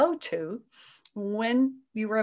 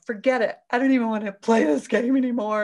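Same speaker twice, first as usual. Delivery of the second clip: louder, exasperated quitting outburst; fed-up outburst; 2 semitones higher, 8 dB louder than usual. Retold or imitated. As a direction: throw the game away loudly. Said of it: "You can go".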